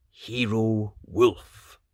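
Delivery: slow, savoured title. Acted. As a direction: draw the words out proudly. Wolf writes title.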